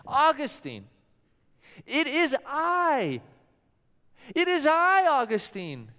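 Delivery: lightly voiced woman calling Augustine; woman calling from afar. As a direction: call out across the distance to him.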